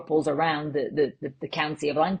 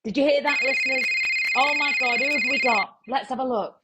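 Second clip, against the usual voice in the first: excited; 6 semitones higher than usual. Acted. Host reacts to incoming message.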